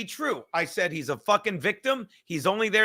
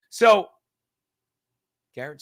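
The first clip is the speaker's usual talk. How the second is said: ordinary delivery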